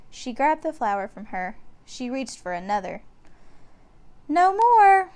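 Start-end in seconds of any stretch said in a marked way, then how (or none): 4.3-5.1 put-on much higher, louder girl's refusal; firm young girl; little girl. A narrator's reading. Annie telling the dinosaur no, high and loud. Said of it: "said Annie".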